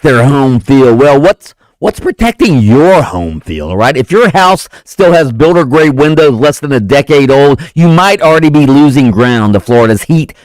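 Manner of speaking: upbeat radio ad read. Acted. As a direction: sell it like a host-read spot. Reads a window ad.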